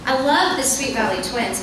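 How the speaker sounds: normal talking voice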